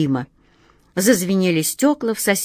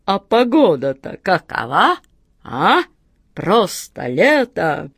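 genie voice with big pitch swoops; delighted genie; slower than usual. A narrator's reading. Genie admiring the summery weather, hearty and delighted. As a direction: marvel in the genie's put-on voice.

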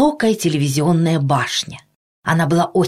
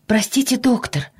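high-pitched, apologetic mother; embarrassed mother; 8 semitones higher than usual. A narrator's reading. Mother interrupting the kind doctor, embarrassed. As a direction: apologise shyly in a higher voice. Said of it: "опять засмущалась мама".

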